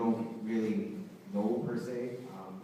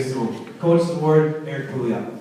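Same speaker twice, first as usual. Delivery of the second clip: announcing winner; 4 semitones higher, 10 dB louder than usual. Real, not acted. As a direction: call the name out to the room. Announcing award winner at banquet.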